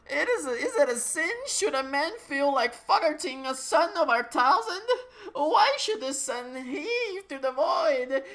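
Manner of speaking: indignant villain. Acted. Dungo Cop defending himself after being insulted, in a high haughty villain voice. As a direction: scoff grandly, as if deeply insulted.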